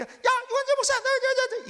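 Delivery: very high, harsh accusing yell; Satan's voice. Shown as a demonstration. Satan accusing the pastor by name, high-pitched frantic shout. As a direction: shriek the name, then jab out each repeated word. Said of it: "사탄의 소리예요".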